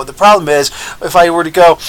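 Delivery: ordinary talk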